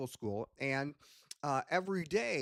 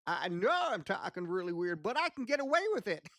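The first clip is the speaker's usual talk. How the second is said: higher-pitched grandpa puppet character; Grandpa Cratchit; 6 semitones higher than usual. Shown as a demonstration. Puppeteer demonstrates an old puppet, high grandpa voice. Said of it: "used to talk like this".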